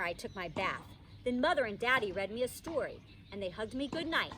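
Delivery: plain reading voice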